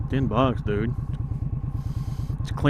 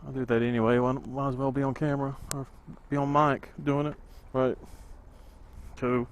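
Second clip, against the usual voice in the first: inside a full face helmet; 14 dB quieter than usual. Real, not acted. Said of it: "full face helmet".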